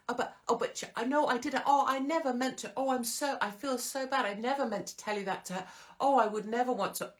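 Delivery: high, gushing fake apology; whiny gossip. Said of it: "and they'll go".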